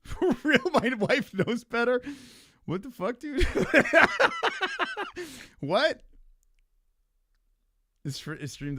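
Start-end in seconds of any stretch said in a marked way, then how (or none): 0.0-2.0 higher, louder disbelief; real disbelief; incredulous. Real, not acted. Reacting incredulously to chat. 3.5-5.5 loud, high incredulous laugh; real laughing fit. Real, not acted. Laughing at chat.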